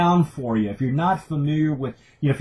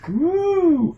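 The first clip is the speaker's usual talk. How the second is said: excited whoop. Real, not acted. Raving about a cocktail.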